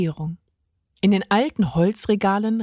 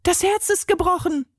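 high dismayed cry; crying out together; 10 semitones higher than usual. A narrator's reading. Both friends see the broken heart, crying out. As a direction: cry it out, shocked. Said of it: "riefen sie gleichzeitig".

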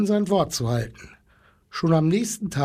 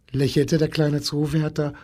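ordinary delivery

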